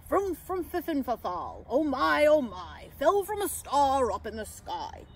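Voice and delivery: marvelling character voice; Santa. A narrator's reading. Santa amazed at where the pixie fell from, marvelling. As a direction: repeat it in wonder.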